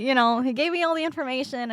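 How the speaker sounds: ordinary talk, nothing marked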